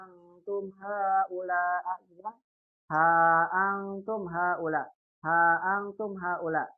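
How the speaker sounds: "ha antum haula" slow, long "ha"; slow Arabic recitation. Shown as a demonstration. Teacher slowly reciting "ha antum haula" as an example. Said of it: "Apa sih maksudnya ha antum".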